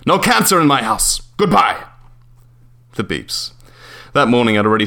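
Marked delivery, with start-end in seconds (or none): 0.1-1.8 higher, clipped foreign landlord's refusal; curt landlord. Acted. Landlord ending the call with a smoker, curt and higher. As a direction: shut the tenant down and hang up.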